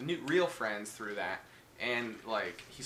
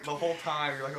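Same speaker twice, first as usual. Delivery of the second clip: usual speaking voice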